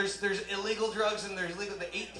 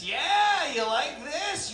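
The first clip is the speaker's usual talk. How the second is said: high, eager taunting questions; hammed-up high-pitched bragging; mock cocky lover; 8 semitones higher, 4 dB louder, much slower than usual. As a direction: ask it like a clueless show-off. Acts out clueless lover.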